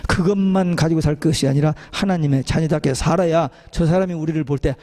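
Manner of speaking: ordinary talk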